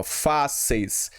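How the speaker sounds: "fáceis" slowly in two beats, fá-ceis; split into syllables. Shown as a demonstration. Teacher showing students how the word separates, split into syllables. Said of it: "Se separa assim".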